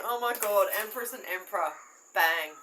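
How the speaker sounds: loud, thrilled gasp at the cards; excited card reveal. Real, not acted. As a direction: burst out with delight at the draw.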